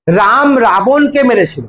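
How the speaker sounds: slow, even, word by word; slow dictation. Shown as a demonstration. Teacher dictating an example sentence to the class, slow and even. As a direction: spell it out slowly for the class to copy.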